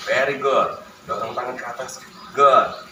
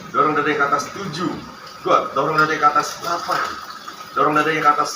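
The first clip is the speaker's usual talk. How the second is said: counting workout reps; 4 semitones higher than usual. Real, not acted. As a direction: call out each rep to push. Therapist counts reps aloud.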